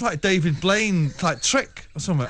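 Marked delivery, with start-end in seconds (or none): none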